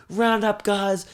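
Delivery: merch hawker. Retold or imitated. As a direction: sell it like a street vendor. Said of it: "'cause he's like".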